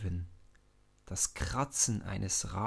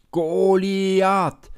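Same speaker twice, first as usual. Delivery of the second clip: drawn-out chant, much louder and higher; shouting crowd; 10 semitones higher, 14 dB louder than usual. A narrator's reading. Philistine army chanting their champion's name, loud and high. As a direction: bellow the name like a whole army. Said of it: "schreien die Philister".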